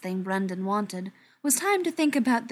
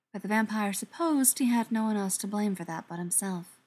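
usual speaking voice

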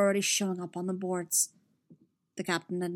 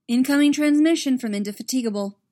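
urgent announcement; 5 semitones higher, 9 dB louder than usual. Acted. Officer announces transmission.

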